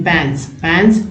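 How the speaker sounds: पैंस said slowly, twice, with a rise; slow model word. Shown as a demonstration. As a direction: spell out पैंस slowly for learners. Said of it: "तो पैंस है". (धीमे से पैंस)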